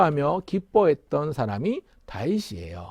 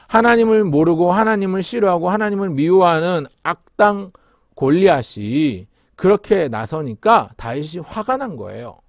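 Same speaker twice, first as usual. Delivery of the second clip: ordinary talk, nothing marked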